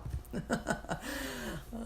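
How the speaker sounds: soft, high giggle; embarrassed laugh. Real, not acted. Vlogger after her bad corona joke, embarrassed giggle. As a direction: giggle quietly at yourself.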